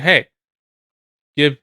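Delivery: usual speaking voice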